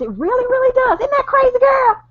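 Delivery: high, bright excited agreement. Real, not acted. Agreeing with her friend, excitedly high. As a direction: egg her friend on, thrilled.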